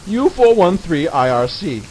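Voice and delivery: normal talking voice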